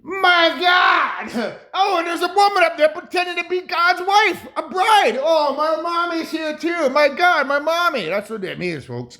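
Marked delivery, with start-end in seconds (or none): 0.0-8.1 put-on a kid yelling that his mommy has come; hammed-up child; thrilled child. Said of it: "it's connected back to".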